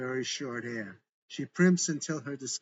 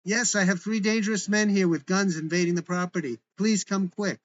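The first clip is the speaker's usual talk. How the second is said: higher, louder urgent plea down the phone; woman grabbed by agents, shouting urgently into her phone; urgent call into phone; 6 semitones higher, 7 dB louder than usual. A narrator's reading. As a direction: call for help while being grabbed. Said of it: "into phone as she's grabbed".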